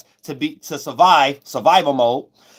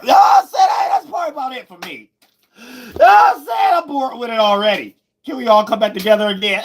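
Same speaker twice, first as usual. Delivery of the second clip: much higher, shouting, then easing off; 7 semitones higher than usual. Real, not acted. Streamer shouts high at chat, bored with the topic.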